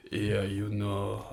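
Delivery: slow, soft, halting manager's voice; lightly imitated, hesitant football manager; Arsene Wenger. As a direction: draw it out softly, as if stalling. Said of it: "when Wenger got interviewed".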